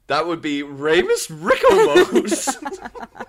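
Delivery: cartoon dog, r on every word; Scooby-Doo voice. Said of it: "you have to say it like Scooby-Doo".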